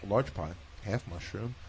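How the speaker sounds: a touch of a casual phone call; himself ordering by phone. Retold or imitated. Retelling calling in a pizza order, low and casual. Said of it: "every time I call an order and say".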